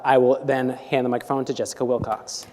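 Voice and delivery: ordinary talk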